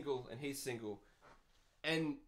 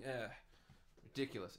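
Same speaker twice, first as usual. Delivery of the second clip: ordinary talk, nothing marked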